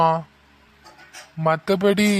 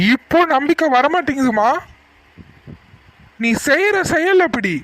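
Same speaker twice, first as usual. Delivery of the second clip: higher, pained reproach; bitter anger; 7 semitones higher than usual. Acted. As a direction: tell her she has broken his trust. Father voices lost trust angrily. (வருத்தமான கோபம்)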